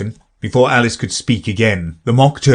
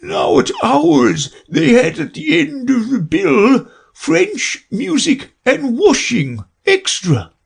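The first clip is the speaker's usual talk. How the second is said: very high, slow and tearful; a sobbing old turtle; weepy Mock Turtle voice; slower than usual.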